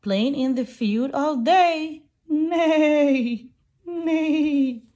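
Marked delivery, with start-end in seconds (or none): 2.3-4.8 higher, loud whinnying neigh; horse neigh. Acted. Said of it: "Horses munch on yummy hay".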